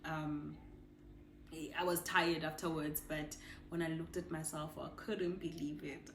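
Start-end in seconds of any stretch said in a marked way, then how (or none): none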